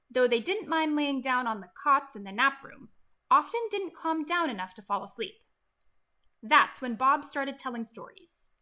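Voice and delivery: plain reading voice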